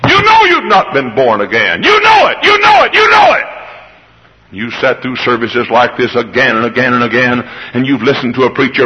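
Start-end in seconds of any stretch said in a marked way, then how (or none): 0.1-3.4 high, loud repeated shout; shouted accusation. Acted. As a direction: hammer each one harder. Preaching at full force.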